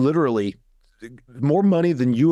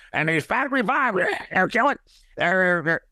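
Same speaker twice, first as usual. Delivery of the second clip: much higher, soprano-like mock of RFK Junior; RFK Junior impression; 6 semitones higher than usual. Imitating RFK Junior for the host, pitched way up and mocking. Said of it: "He makes me sound like a soprano 'cause he talks like this".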